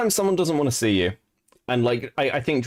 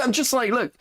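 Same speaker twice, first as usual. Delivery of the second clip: normal talking voice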